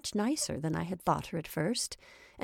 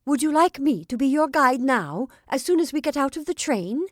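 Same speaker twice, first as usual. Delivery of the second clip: higher, louder furious demand; 7 semitones higher, 7 dB louder than usual. A narrator's reading. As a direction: press her offer in rising fury. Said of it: "looking more and more furious".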